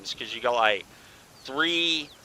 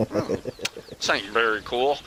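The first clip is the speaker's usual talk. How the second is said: puzzled mock mutter; slower than usual. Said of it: "and you're just like".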